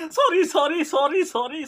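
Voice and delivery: laughing apology. Real, not acted. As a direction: apologise while still cracking up. Apologising while still laughing.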